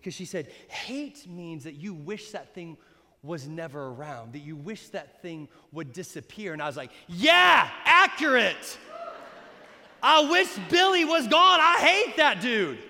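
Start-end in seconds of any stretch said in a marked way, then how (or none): 0.7-6.5 hushed, low British grandmother explaining; polite British accent. Retold or imitated. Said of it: "in her really polite British accent". 7.1-12.8 loud, heated kid's comeback, hammed-up; mock angry shouting. Acts his angry childhood reply.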